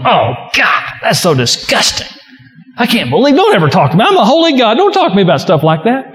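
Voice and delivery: mock a God who is repelled by you; mock disgusted God. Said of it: "God's not sitting up on His throne going".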